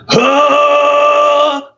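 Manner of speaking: clean, high sustained "huh". Shown as a demonstration. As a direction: hold a high, open note. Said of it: "turns into".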